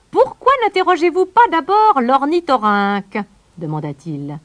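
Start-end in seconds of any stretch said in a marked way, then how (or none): 0.1-3.2 high, loud bird voice; loud, high-pitched teasing question; amused kingfisher. A narrator's reading. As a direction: pipe it up high, still amused. Laughing kingfisher puts a question.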